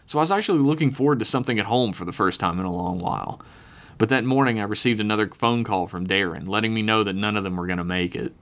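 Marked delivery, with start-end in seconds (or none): none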